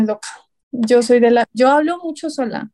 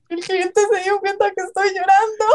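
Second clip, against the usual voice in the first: tearful report; 7 semitones higher than usual. Retold or imitated. Telling the supervisor about crying, teary. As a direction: say it half-crying. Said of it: "y a mi supervisora".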